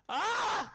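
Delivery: short, high-pitched yelp; sudden scream. Real, not acted. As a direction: yelp out in the game. Cry during game.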